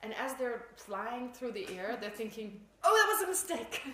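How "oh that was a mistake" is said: loud, high cry of sudden realisation; mock panicked regret. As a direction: blurt it out like a comic last thought. Acts out falling person's sudden regret.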